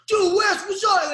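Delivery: high, loud friendly hail; young cop loudly greeting Wes; excited greeting. Retold or imitated. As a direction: call out like a young fan. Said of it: "He's like".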